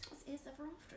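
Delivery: plummy upper-class English; posh voice. Pretend. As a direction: say it terribly posh. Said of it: "Why is it saying in a posh voice?".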